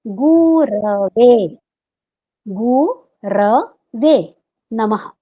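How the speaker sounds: गुरवे chanted very slowly, ra not ru; correct recitation. Shown as a demonstration. Teacher giving the right गुरवे after the wrong one. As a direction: draw out गुरवे नमः like a chant. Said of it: "वो उचित नहीं है".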